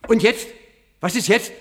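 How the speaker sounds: pressing for news, can't wait; tense eager question. Acted. Professor pressing Maggie for news, high and eager.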